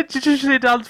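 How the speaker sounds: ordinary talk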